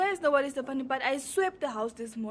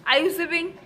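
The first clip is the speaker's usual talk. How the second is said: calling out; 4 semitones higher, 4 dB louder than usual. Real, not acted. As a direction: call her name across the room. Calling to niece across house.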